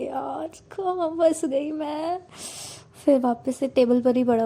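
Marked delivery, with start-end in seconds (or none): 0.0-2.2 high, drawn-out lament to herself; exasperated inner wail. A narrator's reading. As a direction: whine it slowly, holding the temper in. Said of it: "बस control करती है". (खीझ भरी, खिंची हुई शिकायत)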